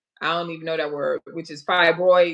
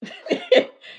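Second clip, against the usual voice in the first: high burst of laughter; 3 dB louder than usual. Real, not acted. Laughing at guest's mishap.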